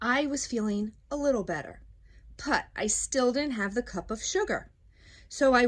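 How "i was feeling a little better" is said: plain reading voice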